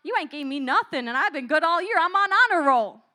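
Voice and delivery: loud, high, protesting whine; a good student cheated of candy; complaining kid rising to a shout; indignant kid. Retold or imitated. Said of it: "you're like".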